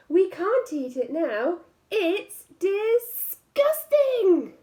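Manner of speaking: high, slow, squeaky disgust on disgusting; prim mother squirrel. Acted. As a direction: squeal it out like a fussy squirrel mum. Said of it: "said the mother squirrel".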